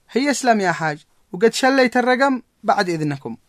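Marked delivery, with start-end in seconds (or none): none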